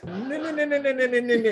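high, rushed string of no's, nervously; a flustered, high-pitched refusal. Retold or imitated. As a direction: panic as she starts asking questions. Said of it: "And I'm really nervous now".

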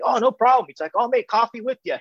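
happy to help, no fuss; cheerful. Retold or imitated. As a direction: Morgan gladly agreeing to make coffee. Morgan answers cheerfully.

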